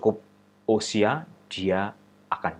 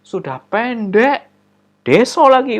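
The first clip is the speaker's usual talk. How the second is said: mocking classmate; 12 semitones higher, 11 dB louder, slower than usual. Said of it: "teman-temannya ketawa-ketawa".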